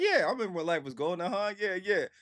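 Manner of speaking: high-pitched, eager chiming in; re-enacted enthusiastic yeah-yeah; eager agreement. Reenacts eagerly agreeing with singer.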